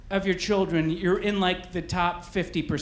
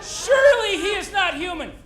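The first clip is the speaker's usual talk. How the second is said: very high, loud, drawn-out gushing; mock awe; 13 semitones higher, 4 dB louder, slower than usual. People online marvel at a dad feeding his children, in hammed-up awe. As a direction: overdo the reverence, nearly singing each word slowly.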